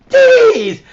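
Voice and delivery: high, loud burst of mock shock; a sudden squeaky exclamation; jeez. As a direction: yelp it out in startled disbelief. Reacting to the hoop outfit.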